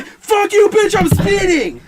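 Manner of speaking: high, loud yelled insult; mock angry shout. Crazy mom yelling at drivers, mock angry shout. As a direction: yell it like a road-raging mom. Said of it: "with her going".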